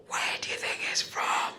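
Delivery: hushed, secretive whisper. Retold or imitated. Strangers guessing her origin, whispered gossip. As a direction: whisper it behind someone's back. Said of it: "I'll hear a whisper".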